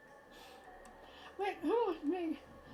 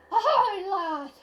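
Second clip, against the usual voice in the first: loud hearty pirate hail, pitched up; pirate greeting; 3 semitones higher, 10 dB louder, slower than usual. Acted. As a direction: bellow it like a jolly sea captain. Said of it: "Hey there, Mister Pirate".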